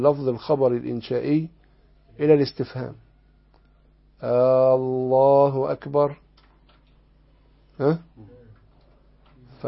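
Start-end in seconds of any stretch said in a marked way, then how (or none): 4.2-6.2 الله stretched slowly as a question-like Allah; wrong drawn-out hamza. Shown as a demonstration. Teacher demonstrating the wrong takbir, slow drawn الله. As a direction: drag out الله so it sounds like asking. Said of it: "ألا يمد همزة الجلالة".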